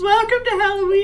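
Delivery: high, loud fake wail; mock crying. Co-host opening the crying Halloween podcast, mock sobbing. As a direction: wail it like a tearful podcast intro. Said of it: "This is the crying Halloween-themed podcast".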